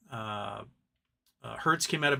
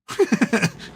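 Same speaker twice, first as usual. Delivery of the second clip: short loud burst of laughter; real laugh, loud and quick; amused laugh; 7 semitones higher, 6 dB louder than usual. Real, not acted. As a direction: laugh out at the absurdity. Laughing at the absurd advice.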